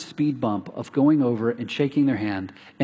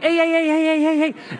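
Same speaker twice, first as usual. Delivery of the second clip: much higher, loud calls of hey; calling out; 4 dB louder than usual. Retold or imitated. Pastor retelling how he called out to his neighbour, high and loud. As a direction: hail the neighbour before the garage door shuts.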